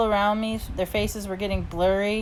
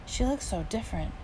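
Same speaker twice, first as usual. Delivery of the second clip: soft hushed aside; whispering; 2 semitones higher, 8 dB quieter than usual. A narrator's reading. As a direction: lean over and murmur it under your breath. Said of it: "Mom whispered to me".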